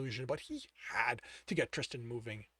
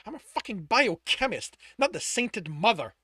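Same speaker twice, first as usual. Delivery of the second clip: angry; 6 semitones higher, 6 dB louder than usual. Acted. Angry retort in dialogue.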